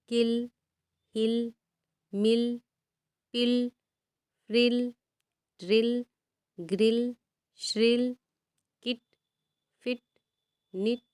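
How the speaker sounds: each word spaced out, short clipped i as in "इन"; careful short i demo. Shown as a demonstration. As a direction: read "इन" "फिल" "किल" with a short i, one by one. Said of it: "तो उसको सिर्फ आई पढ़ेंगे।".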